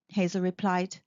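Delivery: ordinary delivery, nothing marked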